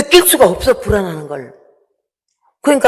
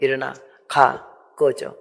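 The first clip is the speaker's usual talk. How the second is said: curt commands; 5 semitones lower, 6 dB quieter than usual. Retold or imitated. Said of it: "나를 교육을 했어요".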